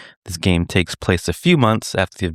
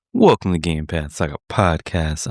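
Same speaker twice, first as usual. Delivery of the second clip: ordinary delivery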